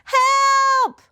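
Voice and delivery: high, loud cry for help; shout for help. Acted. Bebe shouting for help for Max in the soup. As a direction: yell as if someone is drowning.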